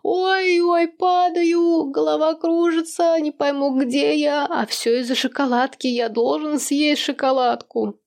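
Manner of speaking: a clowning father moaning from the roof; hammed-up dizzy moaning. A narrator's reading. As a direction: fake a dizzy spell for chocolate. Father fakes dizzy fall from roof.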